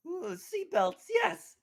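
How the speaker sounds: high, eager squeal of delight; excited planning. Acted. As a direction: gush over the idea.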